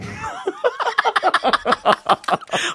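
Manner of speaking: long, high laughing fit; real laughter. Real, not acted. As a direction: burst out and keep laughing. Laughing at teasing remark.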